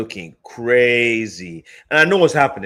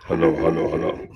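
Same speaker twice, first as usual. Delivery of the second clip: toy echo microphone; 7 semitones lower than usual. Real, not acted. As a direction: toy mic voice changer, lower. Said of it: "I got my special mic here".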